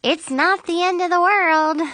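high, upbeat and playful; goofy cheerleading. A narrator's reading. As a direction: cheer the friends up with a silly grin. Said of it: "smiling goofily and doing her jazz hands".

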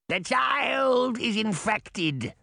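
slow, high witch cry; Baba Yaga shout. A narrator's reading. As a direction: drag it out as a shrieking crone. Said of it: "Baba Yaga said to her family".

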